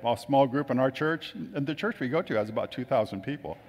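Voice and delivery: ordinary talk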